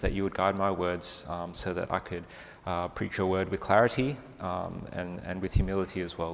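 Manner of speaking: soft, humble prayer voice. Real, not acted. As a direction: pray quietly and earnestly. Opening prayer before the sermon.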